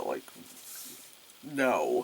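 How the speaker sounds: normal talking voice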